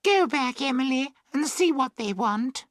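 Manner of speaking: put-on high girlish voice; girl's voice. A narrator's reading. Girl sending Emily back, high girlish voice. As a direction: play a girl, pitched well up.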